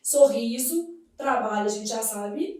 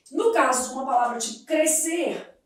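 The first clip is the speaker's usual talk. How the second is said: ordinary delivery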